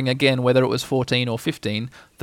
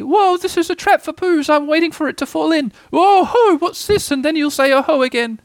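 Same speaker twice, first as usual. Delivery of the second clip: very high, unsettled squeak; tiny panicked creature squeaking away; squeaky; 14 semitones higher, 4 dB louder than usual. A narrator's reading. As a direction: blurt it squeakily, in a fluster. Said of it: "he said very quickly and squeakily".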